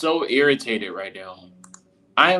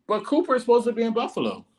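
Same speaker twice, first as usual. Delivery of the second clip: indignant; 11 semitones higher, faster than usual. Real, not acted. Indignant fan protest.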